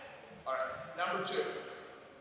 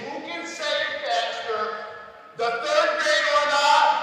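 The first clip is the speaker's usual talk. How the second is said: loud, high, drawn-out challenge; hyped-up shout; 6 semitones higher, 8 dB louder, slower than usual. Real, not acted. Host announcing a bragging-rights contest, hyped-up shout. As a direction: stretch it out and whip up the crowd.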